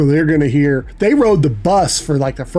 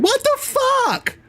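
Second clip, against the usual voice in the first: much higher, slow mock toddler squeak; little kid's voice; slower than usual. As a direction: pipe it up like a three-year-old. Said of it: "pierced by Renly's voice saying".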